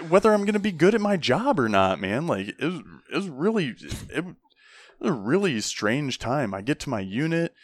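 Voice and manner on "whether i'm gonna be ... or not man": very high, squeaky mock disbelief; high-pitched mock outrage; incredulous recruit. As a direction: play the baffled rookie, voice cracking up high. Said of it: "I'm like".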